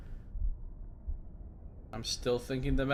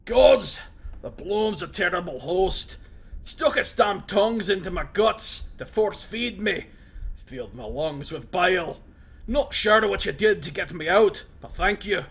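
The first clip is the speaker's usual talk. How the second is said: loud, raised voice of a wounded prisoner; gruff Mazov; 6 semitones higher, 7 dB louder than usual. Acted. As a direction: bark it at the intruder in surprise. Mazov in pain.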